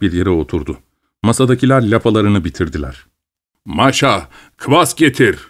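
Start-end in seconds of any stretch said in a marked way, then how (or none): 3.6-5.4 a restrained shout of command to the daughter-in-law; calling out. A narrator's reading. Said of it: "diye bağırdı Maxim Jurkin".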